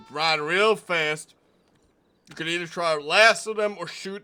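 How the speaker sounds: a proud small-town deputy guarding his porch; stern deputy marshal. Acted. Plays deputy marshal character.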